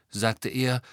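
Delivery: plain reading voice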